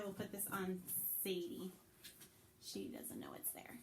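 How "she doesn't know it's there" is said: whisper. Real, not acted. Aside about the unaware dog.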